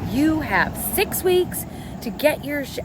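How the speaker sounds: mock harsh high voice, not how it was said; hammed-up stern warning cut off mid-word; exaggerated version. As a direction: overplay it as a mock angry mom. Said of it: "I didn't say it like that".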